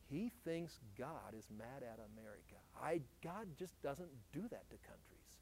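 imagined listener thinking under their breath; hushed doubting thought. Pretend. Someone out there doubting the preacher, hushed and low.

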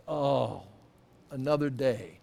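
low, soft and glum; weary morning sigh. Retold or imitated. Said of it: "it's like".

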